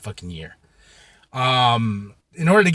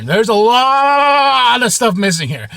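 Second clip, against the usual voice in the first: slower and higher, drawn out in disbelief; dismayed repeat; 6 semitones higher, 4 dB louder, slower than usual. Retold or imitated. Reliving finding his comic boxes short, slow disbelief. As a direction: stretch it out, stunned.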